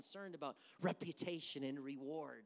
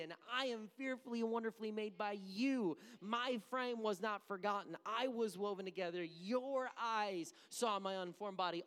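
fervent recitation; 3 semitones higher, 6 dB louder than usual. Acted. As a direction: proclaim it with swelling fervor. Preacher reciting psalm with fervor.

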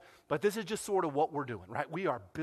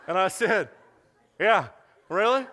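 laughing; 2 semitones higher, 7 dB louder than usual. Real, not acted. Laughing while retelling reply.

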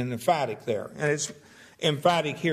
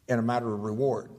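ordinary talk, nothing marked

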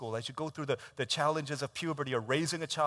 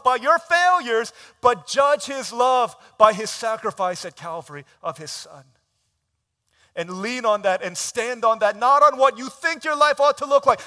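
full-force preaching; 10 semitones higher, 16 dB louder than usual. Acted. Pastor urges the youth group, full-force preaching. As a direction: pound each phrase home from the pulpit.

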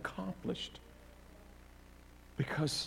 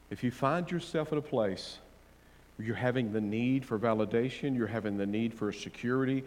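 usual speaking voice

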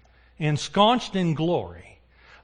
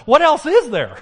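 higher and louder, ringing; triumphant exclamation; 9 semitones higher, 7 dB louder than usual. Acted. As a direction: crown the point triumphantly. Climax of the sermon.

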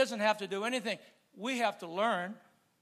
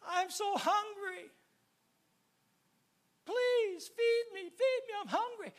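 high, slow pleading whine; mock high-pitched whimper, drawn out; whining hungry beggar; 12 semitones higher, slower than usual. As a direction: beg for food like a pitiful starving man. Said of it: "and he says".

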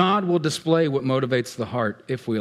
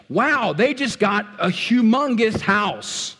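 drawn-out, higher envious wow; envious gasp; 6 semitones higher, slower than usual. Pretend. Preacher mimicking envy at a friend's house post, higher and slow. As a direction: gawk at a friend's big house, slowly.

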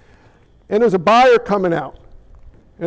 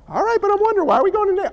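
pitched-up, puzzled whine; high whiny disciple; 9 semitones higher, much faster than usual. Retold or imitated. A confused disciple pestering the others, high whine. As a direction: play the nagging disciple, high and plaintive.

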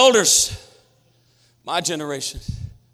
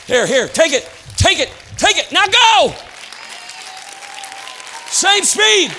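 loud and higher, urging on; calling out; 10 semitones higher, 4 dB louder than usual. Real, not acted. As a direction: shout him on as he runs. Running, calling to Jalen.

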